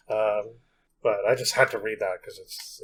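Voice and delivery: usual speaking voice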